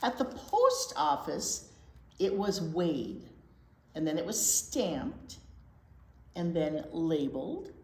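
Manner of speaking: steady storytime read-aloud voice; gentle storybook narration; picture book reading. Acted. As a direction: read it to the little ones, clearly.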